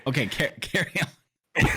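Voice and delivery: giggling through the words; laughing, slow and amused. Real, not acted. Laughing while telling him to continue.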